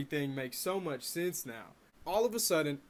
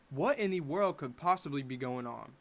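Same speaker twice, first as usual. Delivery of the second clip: usual speaking voice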